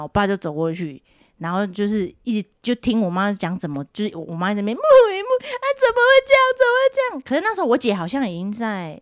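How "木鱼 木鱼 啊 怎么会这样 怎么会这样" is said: high-pitched mock wailing; distraught mum on the phone, imitated; imitating panicked mother. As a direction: lament in hammed-up disbelief, pitch way up. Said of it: "怎么那么慌张".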